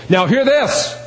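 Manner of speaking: divine voice from heaven. Pretend. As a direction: announce it like God calling from heaven. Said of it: "a divine voice from heaven saying".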